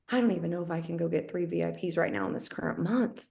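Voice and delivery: low, quiet self-doubt; retold low feeling. Discouraged seller doubting the monthly target, low and soft. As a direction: replay a discouraged admission under your breath. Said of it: "That's how low I was feeling".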